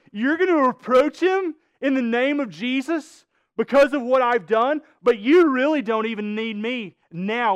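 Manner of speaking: impassioned, high and loud sermon delivery; fired-up preaching. Real, not acted. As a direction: drive the point home to the congregation. Preacher builds to full force.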